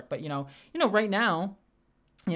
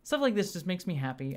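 usual speaking voice

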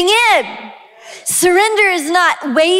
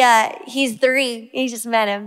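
ordinary talk

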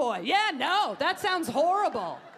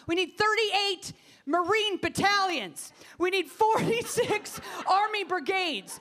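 mock Carly listing; 6 semitones higher than usual. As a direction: impersonate a candidate barking demands. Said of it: "She's like".

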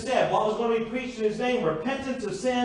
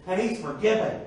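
ordinary talk

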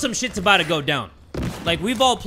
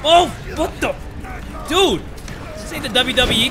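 startled outburst; 5 semitones higher than usual. Real, not acted. Attacked by enemy.

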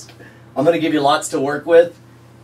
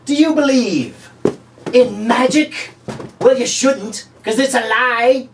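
high, showy infomercial pitchman voice; scam pitchman; 8 semitones higher, slower than usual. Pretend. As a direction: sell the lie like a cheesy TV huckster. Pitchman character voice.

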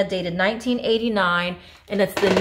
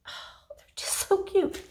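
cooing whisper; 12 semitones higher, 7 dB quieter than usual. Real, not acted. Whispering over cute cake toppers.

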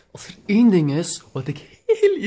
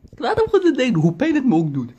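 "Ik laat hem gewoon zijn ding doen" squeezed out high and loud; choked falsetto, pretending to stay calm; high strained squeal; 11 semitones higher, 3 dB louder, faster than usual. Shown as a demonstration. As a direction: force the words out in a high, tight squeal of suppressed rage. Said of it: "repeated differently".

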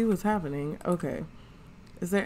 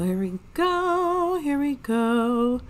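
slow sing-song, high start then settling; slow teasing here we go; drawn-out here we go; 6 semitones higher, slower than usual. Real, not acted. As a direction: stretch it out like bracing for drama. Anticipating the Sims argument.

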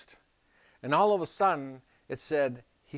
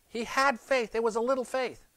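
ordinary talk, nothing marked

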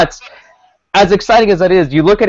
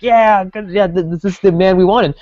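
higher-pitched imitation of excited fans; mock excitement; 4 semitones higher than usual. Imagined fans greeting the new manager, mock excited. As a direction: act out fans thrilled at the news. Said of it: "very few people were excited".

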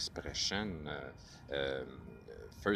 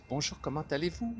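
high-pitched, brisk Parisian French; Parisian French accent; 8 semitones higher, faster than usual. Shown as a demonstration. Demonstrating modern French of France to learners, high-toned Parisian accent. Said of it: "it's a little high tone".

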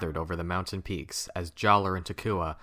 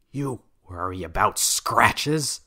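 slow, louder, bitter retort; angry; 2 semitones higher, 5 dB louder, slower than usual. Acted. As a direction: snap back in anger. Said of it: "he said angrily to Gali".

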